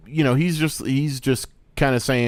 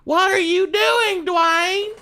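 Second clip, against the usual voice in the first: very high, slow, whiny Southern belle imitation; mocking Whitley's voice; much slower than usual. Co-host mocking Whitley's annoying voice, high whiny impression. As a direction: mimic her annoying high whine, drawn out. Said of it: "how annoying Whitley's voice is".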